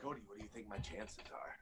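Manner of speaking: fast, breathy whispered question. Acted. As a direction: whisper it conspiratorially. Sudden aside to interviewer.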